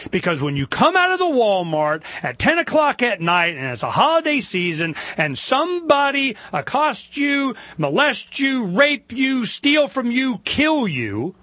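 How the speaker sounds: high, slow, emphatic list of outrages; impassioned. Real, not acted. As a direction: hammer out each crime, stretched and indignant. Impassioned list of crimes against victim.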